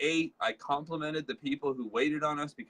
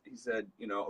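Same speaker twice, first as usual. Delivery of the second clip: usual speaking voice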